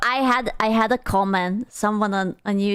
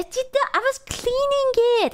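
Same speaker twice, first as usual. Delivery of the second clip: much higher squeak; squeaky defensive; 12 semitones higher than usual. Real, not acted. Streamer squeaks defensively about cleaning the headset. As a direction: protest in a tiny high voice.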